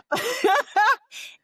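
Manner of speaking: a short, high burst of laughter; bright laugh. Real, not acted. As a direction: laugh at yourself, amused. Laughing at own talking skill.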